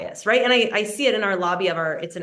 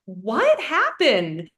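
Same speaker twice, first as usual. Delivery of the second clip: higher, startled, slowing into the question; alarmed surprise; 10 semitones higher, slower than usual. Retold or imitated. Runner seeing her crying coach, startled and concerned. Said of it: "And I'm like".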